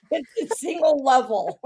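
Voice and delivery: giggling through the words; laughing joke. Real, not acted. Joking to her cohost about her box address, laughing. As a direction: laugh while delivering the gag.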